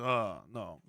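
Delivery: low, quiet Russian "oh, no"; a quick mock Russian denial; lightly put-on Russian. Ovechkin denying he's a spy, low mock Russian. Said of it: "And he goes".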